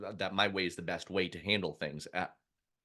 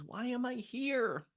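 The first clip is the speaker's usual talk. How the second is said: high, drawn-out querulous question; high-pitched mock-bewildered mom; imitating mom; slower than usual. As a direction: play a confused mom asking, high and slow. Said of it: "I joke with my mom ... she goes".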